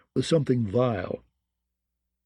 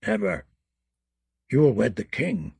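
terrible deep voice; 4 semitones lower than usual. A narrator's reading. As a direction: mutter like an ancient witch. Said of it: "her terrible, deep voice".

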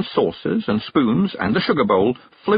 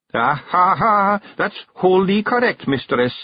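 higher, slow proud Scottish pixie; 10 semitones higher, 3 dB louder, slower than usual. A narrator's reading. Daft Willie answers the witch, proud silly pixie. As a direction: crow with daft pride.

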